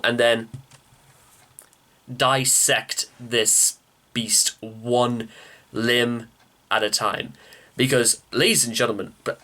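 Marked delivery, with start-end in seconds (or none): 2.1-7.3 slow dramatic. Acted. Dramatic surgery metaphor.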